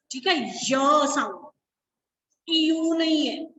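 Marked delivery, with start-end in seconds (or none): 0.5-1.5 "य" drawn out slowly as a y sound; demonstrated y sound. Shown as a demonstration. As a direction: hold "य" long so students hear the glide. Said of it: "तो य sound आ रही है". ("य" की आवाज़ को खींचकर, ज़ोर से साफ़ बोलते हुए)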